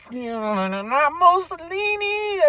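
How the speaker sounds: high, drawn-out slow mock announcer; high, slow imitation of a wrestling manager; Jim Cornette impression. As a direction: stretch it out like a sneering promoter. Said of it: "as Mister, uh, Jim Cornette would say".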